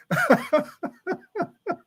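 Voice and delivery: high, wheezy burst of laughter; cracking up. Real, not acted. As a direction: crack up helplessly at being teased. Cracks up at viewer's teasing comment.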